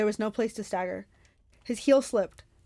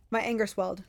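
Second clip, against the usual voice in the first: usual speaking voice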